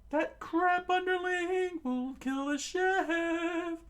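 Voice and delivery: very high, loud, slow lilting warning; high sing-song voice. Acted. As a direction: warn in high voice. Sanji warns about Krieg.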